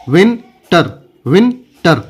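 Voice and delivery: "Winter" in two beats, win... ter; broken into syllables. Shown as a demonstration. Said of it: "कैसे बोलेंगे?".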